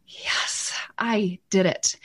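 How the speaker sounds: hushed, breathy little victory; whispered triumph. Retold or imitated. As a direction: whisper it like a secret win. Voicing a mom's quiet triumph.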